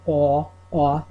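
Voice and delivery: "aw" rounded, drawn out; "aw" said deep and rounded, Long Island. Shown as a demonstration. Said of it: "the best way I can describe it is".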